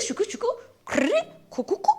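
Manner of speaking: high, quick creaks and clicks; imitated crackling. Describing the bridge to viewers, imitated crackling sounds. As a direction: mimic a rickety bridge creaking. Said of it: "they make, like, some crackling sounds".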